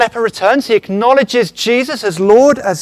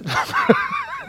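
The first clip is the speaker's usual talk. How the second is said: sudden high, laughing blast of a yell; megaphone shout; 6 dB quieter than usual. Shown as a demonstration. Preacher showing the congregation a megaphone, with a high laughing yell. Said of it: "Do you all know what a megaphone is?".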